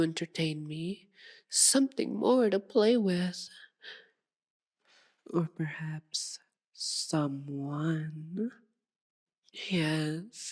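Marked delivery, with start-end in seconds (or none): 5.3-10.5 very slow, soft and breath-filled; suggestive murmur. Acted. Vampire hinting at hunter, slow murmur. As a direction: draw it out as a hint.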